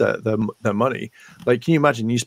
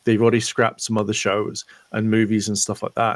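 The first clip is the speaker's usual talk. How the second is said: normal talking voice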